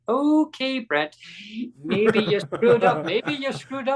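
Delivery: high, drawn-out inner voice; mock self-reproach. Brent realizing his mistake, comic self-reproach. Retells his embarrassed thought to himself.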